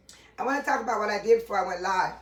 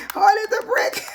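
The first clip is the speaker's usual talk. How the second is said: much higher, louder, laughing out the words; hard as a brick, cracking up; laughing shriek; 12 semitones higher, 7 dB louder than usual. Real, not acted. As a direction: squeal it through tears of laughter. Repeats through laughter.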